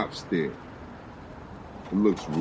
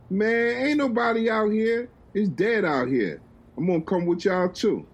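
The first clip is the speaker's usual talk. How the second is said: Will cajoling; 10 semitones higher than usual. Acted. Will joins them.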